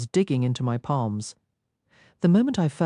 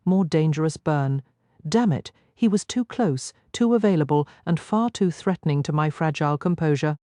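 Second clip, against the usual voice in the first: ordinary delivery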